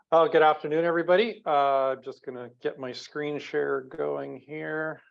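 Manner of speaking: normal talking voice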